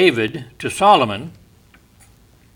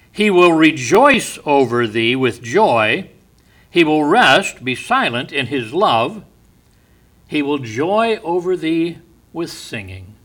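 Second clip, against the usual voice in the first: preaching; 3 semitones higher, 5 dB louder than usual. Acted. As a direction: proclaim the verse with power. Preaches the verse with force.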